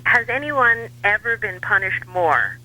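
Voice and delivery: ordinary delivery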